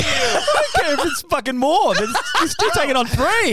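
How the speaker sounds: high, loud laughing outburst; laughing shout. Real, not acted. Friend shouting through laughter about the fight.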